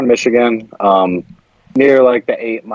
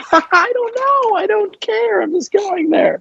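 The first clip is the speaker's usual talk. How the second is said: much higher, slow falsetto laughing it off; squeaky falsetto; slower than usual. Pretend. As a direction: shrug it off in a silly high squeak. Jokey falsetto shrug.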